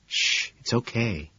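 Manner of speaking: soft, slow hushing; soothing. Acted. Woman reassuring her anxious date, soothing. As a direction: hush and reassure gently. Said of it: "she says soothingly".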